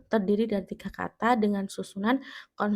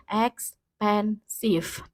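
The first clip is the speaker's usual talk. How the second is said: expensive very slowly, broken into ex-pen-sive; syllable by syllable; much slower than usual. Shown as a demonstration. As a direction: separate each syllable of "expensive". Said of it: "dihitung dari kita mengucapkannya".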